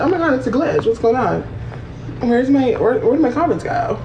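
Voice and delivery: high-pitched mock confusion; mocking imitation. Mocking her glitch excuse, high-pitched imitation. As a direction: mimic her bewilderment in a high voice. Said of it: "She said".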